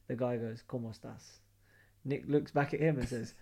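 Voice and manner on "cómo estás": low-key, soft greeting in Spanish; soft, understated quote; quiet retold greeting. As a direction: say it softly, as a casual hello. Retold stranger greets Nick in Spanish.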